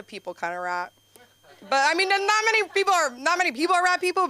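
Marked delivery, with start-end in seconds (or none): 1.7-4.3 fast, higher rush; quick aside. Real, not acted. Explaining to the crowd that few are rat people, fast and rushed. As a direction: rattle it off quickly.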